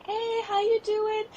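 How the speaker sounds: slow, very high put-on friendliness; high-pitched greeting. Imitating herself greeting parents at school drop-off, high-pitched. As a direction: stretch the hello way up high. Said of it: "That's like me at the school drop-off".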